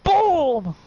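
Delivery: big shouted boom; loud boom. A narrator's reading. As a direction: slam the word out like an impact. GM sound effect climax.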